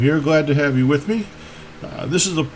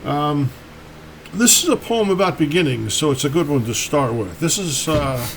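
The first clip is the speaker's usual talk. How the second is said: ordinary talk, nothing marked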